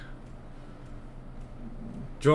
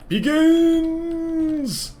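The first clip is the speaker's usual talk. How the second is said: mock grand announcement; 3 dB louder than usual.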